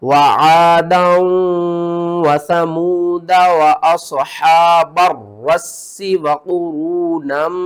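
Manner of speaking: slow, loud, drawn-out chanting; Quran recitation. Shown as a demonstration. Teacher models the verse for students, loud slow recitation. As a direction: recite with full voice, stretching each word. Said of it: "इसको हम किस तरह पढ़ेंगे?".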